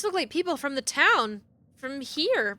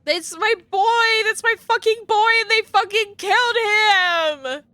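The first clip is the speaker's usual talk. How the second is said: outraged grief; 7 semitones higher, 4 dB louder than usual. Real, not acted. Gamer mourns the boy in the painting, outraged wail. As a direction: wail over him.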